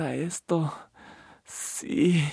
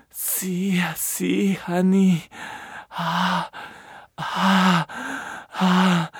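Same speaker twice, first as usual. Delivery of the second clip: usual speaking voice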